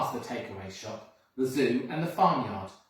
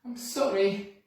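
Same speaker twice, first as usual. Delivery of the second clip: sniffling; 7 semitones higher, 4 dB louder than usual. Acted. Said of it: "he sniffled".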